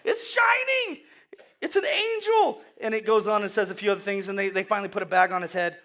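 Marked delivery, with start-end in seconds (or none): 0.0-2.6 very high, loud panicked squeal; hammed-up terrified crowd voice, drawn out; mock freak-out. People fleeing the shining Moses, mock panicked wail. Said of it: "the people freak out".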